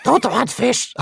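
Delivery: high-pitched creature outburst; Gollum impression. Quotes Gollum's comic interruption.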